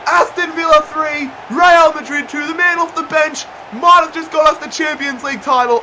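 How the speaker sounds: high, loud roaring commentary building into a scream; elated shouted call of the goal; goal celebration shout. Real, not acted. Winning goal scored late.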